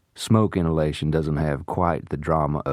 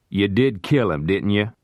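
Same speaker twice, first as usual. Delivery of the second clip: louder, raised accusation; shouted accusation; 4 semitones higher, 4 dB louder than usual. A narrator's reading. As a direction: press him hard, voice raised. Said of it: "Parker shouted at him".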